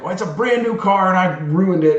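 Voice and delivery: high, loud imitation of an upset wife; distressed protest. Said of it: "She tries to make the argument".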